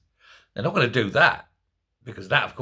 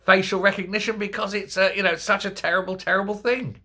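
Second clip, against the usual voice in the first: lightly raised, mock-earnest whining; sarcastic imitation of pearl-clutching opponents; mocking the other side; 6 semitones higher than usual. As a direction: parody the hand-wringing critics, a touch higher. Mocking opponents' civil liberties line.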